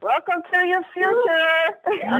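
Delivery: slow, high, crying-out welcome; real joyful cry to the callers; excited cry. Real, not acted. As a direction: stretch it out like a cheer. Cheering on the call.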